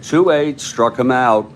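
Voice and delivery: loud, high play-by-play burst; excited strikeout call. Real, not acted. Announcer calling the next batter's strikeout, loud and excited. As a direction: punch out the strikeout from a high start.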